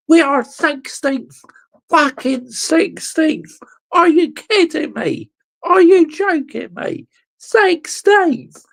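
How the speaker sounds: high, incredulous exasperated shouting; exasperated rant. Real, not acted. Fan venting at his club's sixteenth place, exasperated. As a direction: fan fuming at his team sitting sixteenth. Fan ranting about league position.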